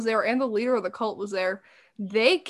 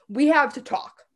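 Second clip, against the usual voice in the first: usual speaking voice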